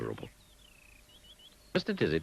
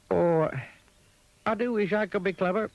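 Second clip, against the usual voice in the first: wistful sigh; 6 dB louder than usual. A narrator's reading. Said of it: "he sighed".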